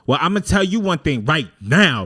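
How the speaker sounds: put-on loud, high Brooklyn girl with a laugh breaking in; hammed-up Brooklyn warning, slow on I'm outside; New York accent. Retelling what the Brooklyn girl told him, in a loud New York accent. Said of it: "And then she goes".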